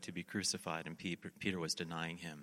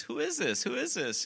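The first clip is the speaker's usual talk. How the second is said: high, loud, rising excitement on the repeat; excited questioning; 13 semitones higher, 6 dB louder than usual. Retold or imitated. Disciples out fishing spot someone on shore, excited questioning. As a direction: call it out higher the second time.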